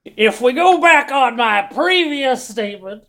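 put-on slow, lightly gruff old sailor voice; a hint of a weathered fisherman, drawn out. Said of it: "go back to that voice that you were doing".

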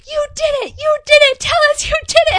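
high, very loud mock scream; hammed-up screaming accusation. The twins screaming at their mother, hammed-up yelling. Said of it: "devolve into yelling".

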